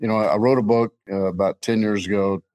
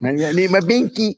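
slightly pouty, higher-pitched little voice; mock baby talk; 8 semitones higher than usual. Host joking after criticism of his voice, mock babyish. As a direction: play a whiny little one, lightly. Jokes about needing a baby's pacifier.

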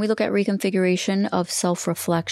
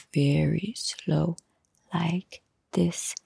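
whisper; much slower than usual. Shown as a demonstration. Slowing down for listeners taking notes, slow whisper. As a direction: breathe it slowly as if giving time to write. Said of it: "I had to whisper there".

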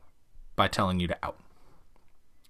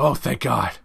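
relieved dog voice; much slower than usual. Retold or imitated. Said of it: "they go".

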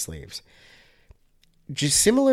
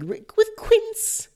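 much higher, hammed-up wealthy British "with quince"; wealthy British accent. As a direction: do a mock rich Brit savouring "quince". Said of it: "I'm impersonating, like, a really wealthy British person".

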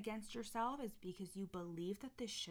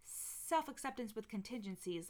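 high mocking voice, eager then flat; mocking past self; 6 semitones higher, 6 dB louder than usual. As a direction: send up your old self, high and loud. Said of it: "I used to be like".

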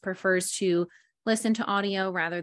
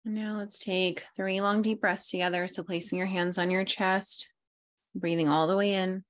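guided meditation; 3 semitones lower than usual. Acted. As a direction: lull them into stillness, fading low. Leads breathing meditation.

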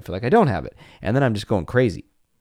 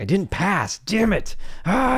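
mock frustrated whine climbing much higher; imitating frustrated kid; 11 semitones higher than usual. Dad imitating his kids failing a piano level, mock frustrated whine.

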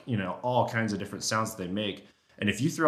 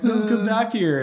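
much higher, begging call; pleading cow voice; 11 semitones higher, 6 dB louder, slower than usual. Retold or imitated. Hunter begging the bull back, high pleading. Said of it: "And I was just like".

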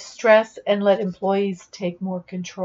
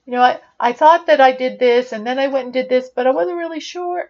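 unsure rising endings, a bit faster, "sure" pitched higher; up talk; 4 semitones higher, 5 dB louder, faster than usual. Shown as a demonstration. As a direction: sound unsure, letting each phrase end go up. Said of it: "at the end of their sentences, they say".

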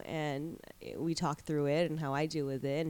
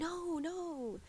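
mom brushing it off; 11 semitones higher, 5 dB quieter than usual. Retold or imitated. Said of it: "And she's like".

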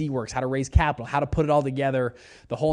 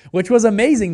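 much higher and louder, thrilled; excited; 8 semitones higher, 9 dB louder than usual. Real, not acted. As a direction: sell the win with a lift. Thrilled at raising first money.

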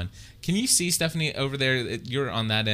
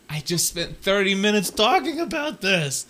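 exasperated; 8 semitones higher than usual. Retold or imitated. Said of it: "It's like".

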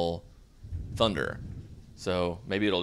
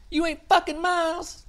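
very high, shrieky gossip voices; teasing girlfriends; 3 dB louder than usual. Retold or imitated. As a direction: squeal it like nosy girlfriends.

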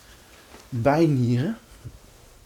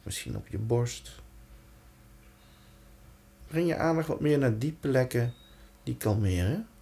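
guided meditation; 2 semitones lower, 4 dB quieter than usual. Acted. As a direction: lead them gently into calm. Guides attention through the body.

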